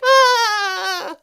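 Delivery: high, loud drawn-out "ah"; cartoon squeal held long; high mock squeal. Creatures lean over the wall smiling, high held mock squeal.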